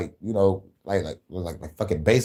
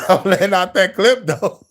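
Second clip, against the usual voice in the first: laughing boast; 7 semitones higher, 5 dB louder than usual. Real, not acted.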